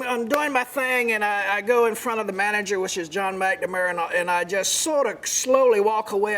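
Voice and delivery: ordinary talk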